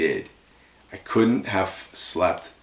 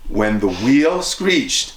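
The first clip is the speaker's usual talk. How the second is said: ordinary delivery, nothing marked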